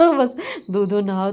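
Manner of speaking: words spilling out through a laugh; giggling mock blessing; laughing quote. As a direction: laugh while you quote her. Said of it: "तो ये एक बोलती".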